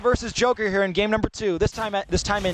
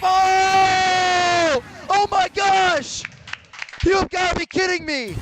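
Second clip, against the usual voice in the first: excited shout; 8 semitones higher, 3 dB louder, much slower than usual. Real, not acted. Caster reacts to a comeback.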